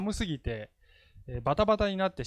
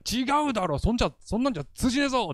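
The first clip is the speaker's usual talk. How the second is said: mock scolding; 8 semitones higher, faster than usual. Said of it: "ってやるわけだね".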